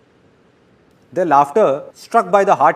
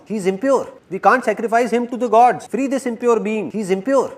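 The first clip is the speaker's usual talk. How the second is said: mock shout, raised in pitch; 7 semitones higher than usual. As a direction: proclaim it high, like an alarmed tribesman. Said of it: "and shouts".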